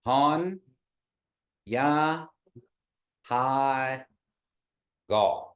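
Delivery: Han, Ya, Hai, Gaw with long pauses between; Kiowa, one syllable at a time. Shown as a demonstration. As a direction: break the phrase into single syllables. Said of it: "You say".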